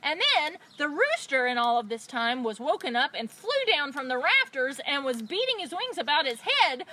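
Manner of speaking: usual speaking voice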